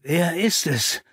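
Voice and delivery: slow, rough-edged question. A narrator's reading. Watson demands the killer's name, roughly. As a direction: rasp the question out. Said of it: "Rauh kam es über meine Lippen".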